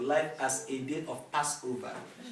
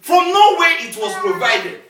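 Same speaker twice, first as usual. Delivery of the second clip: loud, high preaching voice; forceful pulpit emphasis; emphatic proclamation; 7 semitones higher, 10 dB louder than usual. Real, not acted. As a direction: proclaim it like a triumphant climax.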